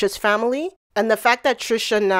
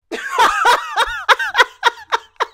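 real drawn-out laughter, pitched up; long high laugh. Real, not acted. Laughs at clip.

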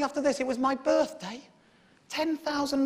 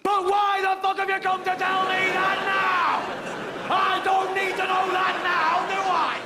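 slow, loud, higher angry yell; furious shout; 6 semitones higher, 3 dB louder, slower than usual. Retold or imitated. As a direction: demand why he tells it now.

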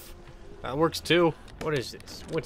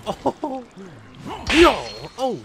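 high, loud cry of no; sudden loud no; shocked shout; 9 semitones higher, 6 dB louder, slower than usual. Real, not acted. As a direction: yell out in dismay. Sudden dismay.

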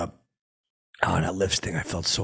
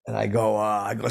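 normal talking voice

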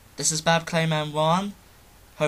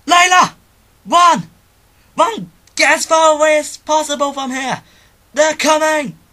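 desperate scream, much higher and much louder; Jubei screaming a warning; 12 semitones higher, 14 dB louder than usual. Acted. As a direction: scream at your daughter to flee for her life. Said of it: "for the role of Jubei".

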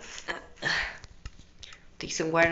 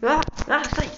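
high, loud frightened scream; panicked scream; 11 semitones higher, 8 dB louder, slower than usual. Real, not acted. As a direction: shriek it in terror. Scream at animatronic. (grito de pânico)

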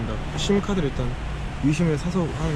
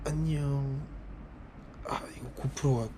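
much softer, low night talk; quiet at night; 5 semitones lower, 13 dB quieter than usual. Real, not acted. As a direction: keep it hushed, people nearby. Late night, people nearby.

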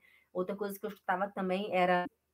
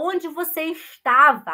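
calling out; 6 semitones higher, 12 dB louder than usual. Acted. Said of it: "Se eu falo".

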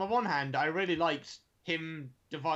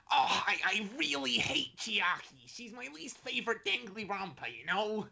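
silly voice; 8 semitones higher than usual. Retold or imitated. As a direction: put on a high squeaky cartoon voice. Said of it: "Is that a Canadian accent?".

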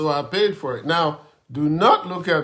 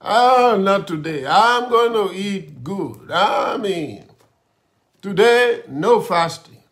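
high, loud insistence on eating; defiant grumbling; 4 semitones higher, 6 dB louder than usual. Retold or imitated. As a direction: stubbornly refuse the fast, loud and pitched up. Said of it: "I'm just grumbling".

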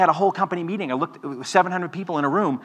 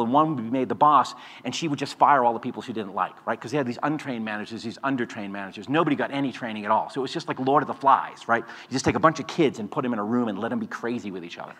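usual speaking voice

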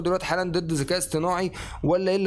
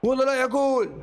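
excited cry; 8 semitones higher than usual. Real, not acted. Gamer watching a near miss, a high excited cry.

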